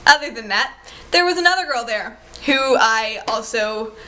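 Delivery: ordinary talk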